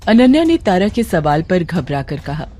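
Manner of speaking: plain reading voice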